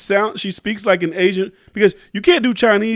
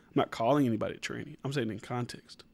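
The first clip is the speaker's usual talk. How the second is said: quiet aside; 8 semitones lower, 13 dB quieter than usual. Real, not acted. Muttered aside, disclaimer.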